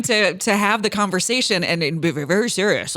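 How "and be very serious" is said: a hint of a grave, solemn radio voice; lightly mock the co-host's serious tone. Teasing the co-host, imitating his serious radio voice. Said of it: "Is that how you think I sound?".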